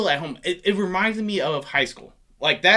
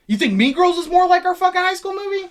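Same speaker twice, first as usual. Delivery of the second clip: high, loud exasperated challenge; exasperated, raised-voice question; incredulous outburst; 12 semitones higher, 7 dB louder than usual. Real, not acted. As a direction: throw it back in disbelief, voice shooting up. Arguing over their generation's movie.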